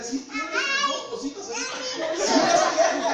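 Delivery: high squeal breaking into laughter; acted giddy new-father glee; gleeful delight. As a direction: crow over it, laughing loudly. Acting delight at the ultrasound.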